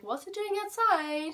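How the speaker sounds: put-on softened question to the child; polite version. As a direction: keep it nice for the kid. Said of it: "I didn't say it like that to the child".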